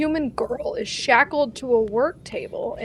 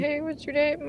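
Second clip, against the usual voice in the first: quoting drow; 4 semitones higher, 7 dB quieter than usual. Acted. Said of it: "You said".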